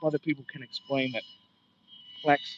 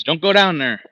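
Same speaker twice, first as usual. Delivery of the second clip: loud, higher warning call; 4 semitones higher, 10 dB louder than usual. Acted. Guide voicing the word's meaning as a loud warning. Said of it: "That means".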